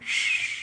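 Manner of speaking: "shh" as a long drawn-out hush; imitated Scottish S. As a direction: draw out "shh" for an S. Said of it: "when she does her S's".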